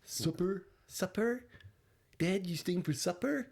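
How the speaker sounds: high, questioning Minnesotan lilt; Minnesota accent. Acted. Host practising the movie's dialect, Minnesota accent. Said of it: "the Minnesota accent".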